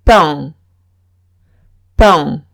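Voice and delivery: pain with a nasal vowel, no n heard; French pain. Shown as a demonstration. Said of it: "Otra palabra es".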